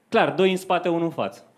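ordinary delivery

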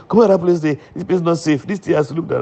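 high-pitched quoted alarm; imitated urgent warning. Quoting a warning that the place isn't safe, urgent and high. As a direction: mimic someone warning of danger, pitched well up.

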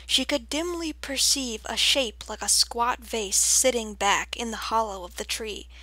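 usual speaking voice

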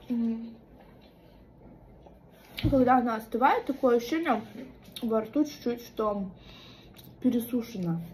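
talking while chewing; muffled speech around food; mouth full. Real, not acted. Seen 0: Roll taken at -1; cheeks bulge, jaw chewing between words.

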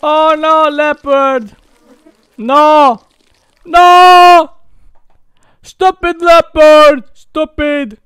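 high, loud cries of protest; high-pitched game panic yelling; alarmed shouting. Real, not acted. Leopard attacks the birds in game.

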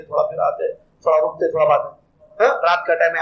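usual speaking voice

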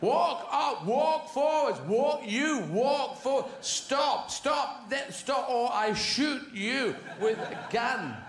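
loud, high barked orders; horse shouter. Retold or imitated. A horse shouter yelling orders at his horse. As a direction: bellow commands at the horse. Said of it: "it's horse shouters".

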